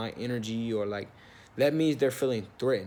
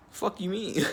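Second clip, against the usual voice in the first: incredulous; 7 semitones higher, slower than usual. Retold or imitated. Acted disbelief at the manager.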